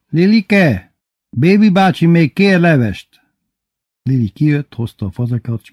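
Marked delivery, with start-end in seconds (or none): 0.1-3.2 high, loud call for service. A narrator's reading. Bébi calling Lilike for more soup, calling out. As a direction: call loudly to the kitchen for more.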